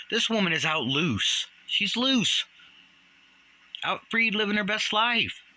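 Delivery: high, emphatic exclamation of outrage; alarmed warning. Real, not acted. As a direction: sound the alarm, pitch climbing high. Warning that she is free.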